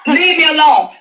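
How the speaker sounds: shouted leave me alone; a real angry shout; loud demand. Real, not acted. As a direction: yell it at her. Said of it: "ranting live at her daughter".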